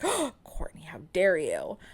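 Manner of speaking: gasped, scandalised whisper as the mother; shocked mother whisper. Retold or imitated. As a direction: whisper it like a horrified mum. Mother gasps, mock scandalised.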